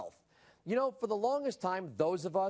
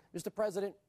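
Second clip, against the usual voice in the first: ordinary delivery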